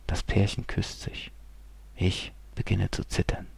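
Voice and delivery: soft unvoiced whisper; trembling inner whisper; hushed. A narrator's reading. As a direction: let it drop to a breath.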